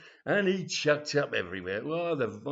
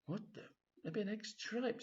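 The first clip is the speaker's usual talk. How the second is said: higher and much softer, under the breath; a horrified little inner voice; shocked thought; 8 semitones higher, 10 dB quieter than usual. Retold or imitated. Freddy's shocked private thought.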